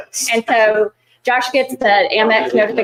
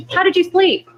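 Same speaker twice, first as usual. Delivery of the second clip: much higher-pitched imitation of spousal small talk; mock-polite spouse; 9 semitones higher than usual. Spouse asking the daily marriage question, mock-polite. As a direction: lift it into a routine marital nicety.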